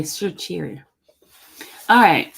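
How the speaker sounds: ordinary delivery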